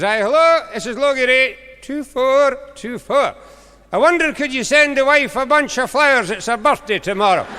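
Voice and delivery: put-on a bumbling old farmer shouting down the line; old farmer on the phone. Old farmer shouting down the phone to the operator.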